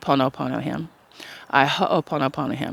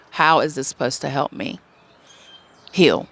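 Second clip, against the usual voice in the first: usual speaking voice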